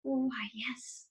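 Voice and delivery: breathy unvoiced whisper. A narrator's reading. Charlie whispering to Mr Wonka in awe. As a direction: whisper it, barely audible. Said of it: "whispered Charlie".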